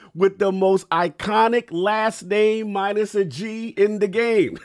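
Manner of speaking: slow, loud hype-man announcement; hype announcer intro. Pretend. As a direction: draw out every title like a ring announcer. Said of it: "clip that intro out so I can just put it before my videos".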